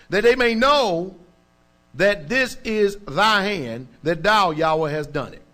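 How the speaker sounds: slow, loud psalm reading from the pulpit; solemn, slowed scripture recitation. Acted. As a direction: read it out like a cry for help.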